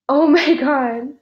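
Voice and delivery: overwhelmed at first sight, drawn out; stunned gasp. Real, not acted. Youtuber seeing her printed book for the first time, awed and slow.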